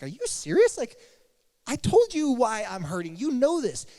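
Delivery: hammed-up indignant disbelief; exasperated.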